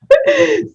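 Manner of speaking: high helpless burst of real laughter. Real, not acted. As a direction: crack up out loud. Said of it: "so I was just laughing".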